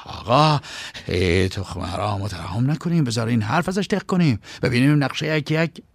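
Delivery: hurried, agitated henchman; a hint of panicked urgency; flustered. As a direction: cut in anxiously to stop the boss. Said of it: "سراسیمه گفت".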